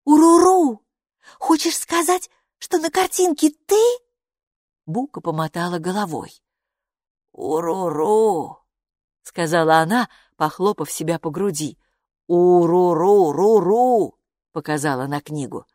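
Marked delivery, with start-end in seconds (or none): 0.0-3.8 high-pitched character working out a riddle; excited guesser. Acted. Хилла guesses in her character voice. 7.3-8.6 slow, drawn-out rolling creature call. Acted. 12.3-14.2 loud, slow rolling creature call; insistent creature call. Acted. Creature repeats its call, pointing.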